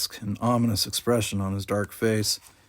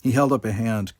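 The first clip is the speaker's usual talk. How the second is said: usual speaking voice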